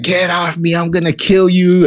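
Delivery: shrill mock threat from pinned-down kid; angry yell. Son pinned to the floor yelling at dad, high furious mock yell.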